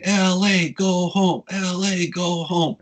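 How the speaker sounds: high-pitched mock heckling chant; imitated crowd yell. San Francisco crowd heckles the L A band, imitated yelling. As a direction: chant it like a jeering crowd, high but only half-yelled. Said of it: "people would be yelling".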